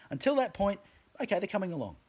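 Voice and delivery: ordinary talk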